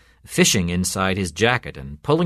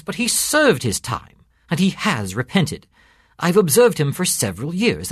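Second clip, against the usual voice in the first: higher-pitched, insistent defence from a priest; earnest priest; 6 semitones higher than usual. A narrator's reading. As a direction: preach his case with rising conviction.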